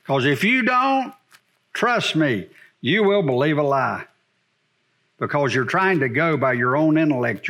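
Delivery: normal talking voice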